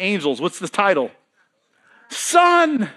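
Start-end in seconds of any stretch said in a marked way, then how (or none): 2.1-2.9 triumphant answer. Real, not acted. Answers his own question.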